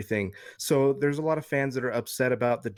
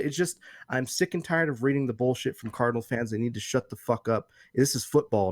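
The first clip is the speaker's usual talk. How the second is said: normal talking voice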